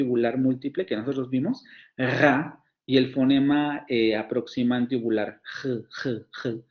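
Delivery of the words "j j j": ordinary talk, nothing marked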